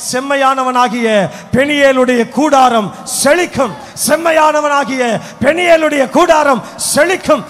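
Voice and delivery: loud preacher's declaration; proclaiming. Acted. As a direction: declare it over the congregation. Preacher proclaiming the year's promise. (முழக்கமாக அறிவிப்பு)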